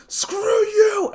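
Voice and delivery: very high, loud; furious yell. Acted. Villain yelling at Izuku as he charges. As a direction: roar as he charges.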